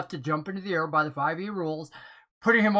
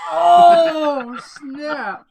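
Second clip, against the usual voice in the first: high loud surprised cry; surprised shout; 8 semitones higher, 9 dB louder than usual. Real, not acted. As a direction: shout it in shock. Reacting to the insult.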